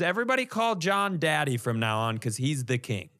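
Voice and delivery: normal talking voice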